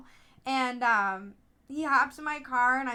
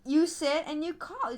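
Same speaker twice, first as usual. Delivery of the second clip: ordinary delivery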